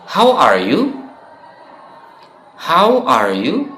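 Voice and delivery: How are you pitched high with a rising end; cheerful classroom How are you; model question. Shown as a demonstration. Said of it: "kita bisa mengatakan".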